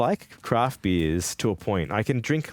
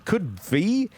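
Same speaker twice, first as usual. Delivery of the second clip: high, rising disbelief; incredulous echo; 10 semitones higher than usual. Real, not acted. Streamer tripping over their own words, incredulous. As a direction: query your own slip, pitched right up. Catches own garbled wording.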